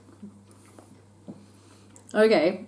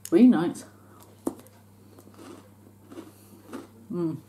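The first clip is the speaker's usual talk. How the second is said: talking between bites; chewing chocolate bar. Real, not acted. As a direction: mouth full of chocolate. Seen 0: Bites bar at 0.1–1.4, then chews with closed, full mouth.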